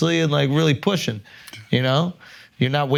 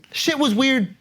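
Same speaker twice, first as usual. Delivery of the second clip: high, laughing emphasis; excited; 12 semitones higher, slower than usual. Real, not acted. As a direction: pitch it way up, amused. Repeated point, voice jumps up.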